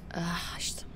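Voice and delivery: soft whispered groan; dreading whisper. Acted. She whispers in dread seeing the two drunks ahead. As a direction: breathe it out under your breath in dread.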